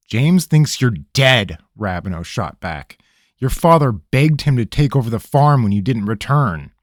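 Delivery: an irritated ranger; stern interrogator. Acted. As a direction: cut through his stalling. Rabineau voice.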